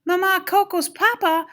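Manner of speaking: high, excited exclamation; excited cry. A narrator's reading. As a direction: cry it out with a thrilled discovery. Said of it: "Miguel cried".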